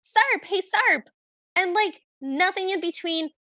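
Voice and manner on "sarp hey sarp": mock yell after someone, pitched way up; imitated yelling. Imitating Cinderella running and calling Sarp, half-voiced high yell.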